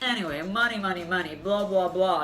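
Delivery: loud mock bragging patter; imitating a windbag, dismissively; mocking boastful man. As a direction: parody a self-important money talker. Mimics date droning about investments.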